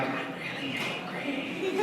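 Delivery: slow, strained whispered mock anger; hushed, comically restrained fury; angry whisper. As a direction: try to rage while only whispering. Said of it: "Now angry".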